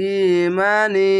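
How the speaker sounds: ইমানি chanted slowly, long a held one beat; slow tajweed recitation; one alif stretch. Shown as a demonstration. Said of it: "এক আলিফ টেনে পড়বেন".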